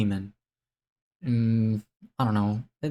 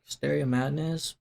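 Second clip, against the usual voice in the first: ordinary talk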